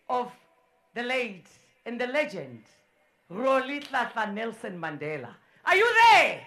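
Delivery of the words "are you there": loud, high roll-call shout. Real, not acted. MC calls out to Zambia's team, shouting. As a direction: shout it across to the crowd.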